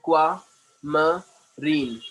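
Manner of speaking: "aquamarine" very slowly, split into separate syllables. Shown as a demonstration. Teacher models the word for a student, syllable by syllable. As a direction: cut "aquamarine" up with a gap after each syllable. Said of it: "syllable में cut करके बोलना".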